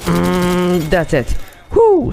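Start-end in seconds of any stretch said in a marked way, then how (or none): 1.7-2.1 victory whoop. Real, not acted. Squad wiped out.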